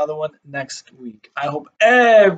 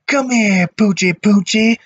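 coaxing burglar; 6 semitones higher, 5 dB louder than usual. Acted. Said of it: "whispered the other".

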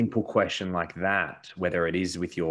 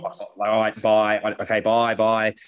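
loud, curt goodbyes to dad; fed up son hanging up the phone; annoyed brush-off; 3 semitones higher, 8 dB louder than usual. Retold or imitated. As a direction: cut him off and hang up, irritated.

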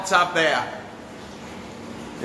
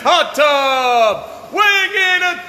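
hyped sales pitch; 6 semitones higher, slower than usual. Real, not acted. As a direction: shout the specs like a showman. Salesman hyping hot tub specs.

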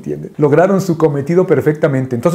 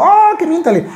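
insistent urging; 14 semitones higher than usual. Retold or imitated. Old man insists.

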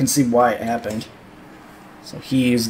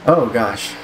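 ordinary delivery

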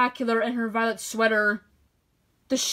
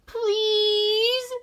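long, much higher begging please; pleading; 10 semitones higher than usual. Acted. Sheep begs the farmer to buy sweater, high pleading voice. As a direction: beg sweetly like a puppy. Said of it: "looked at him with puppy dog eyes".

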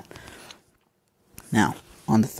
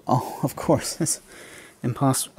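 ordinary talk

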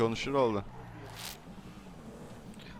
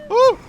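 a sudden high "huu" yelp of delight; high excited whoop; 15 dB louder than usual. Acted. Eating in the game, whooping for joy.

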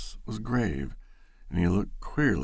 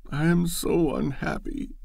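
higher, tearful wail; mild wail; 8 semitones higher than usual. Acted. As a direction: Loretta wailing to Bashford, a touch of sobbing. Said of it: "she wailed".

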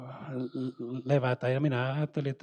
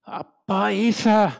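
higher, swelling call to the Father; rising cry; 5 semitones higher, 5 dB louder, slower than usual. Retold or imitated. Preacher recounts the voice rising over the screaming, swelling cry. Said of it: "nousi suureksi huudoksi kaiken sen kirkumisen yli".

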